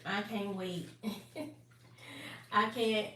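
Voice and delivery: usual speaking voice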